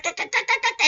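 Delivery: high-pitched staccato squawking; cartoonish chattering noise; mock nagging. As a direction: imitate your own yapping in high repeated syllables. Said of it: "Please watch it".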